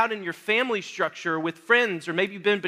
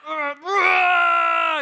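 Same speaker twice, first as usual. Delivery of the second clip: straining grunt; 7 semitones higher, 4 dB louder than usual. Retold or imitated. Acting out the bench press strain.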